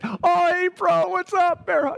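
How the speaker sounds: high-pitched casual bro greeting; drawn-out, laid-back "hey, bro"; easygoing Jesus. Acted. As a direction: hail a buddy while strolling along. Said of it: "just kinda walking, like".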